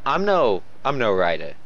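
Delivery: normal talking voice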